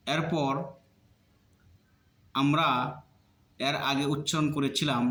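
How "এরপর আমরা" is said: ordinary delivery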